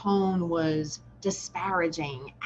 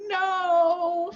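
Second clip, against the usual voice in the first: put-on children answering no; 10 semitones higher, 5 dB louder than usual. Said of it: "we're like".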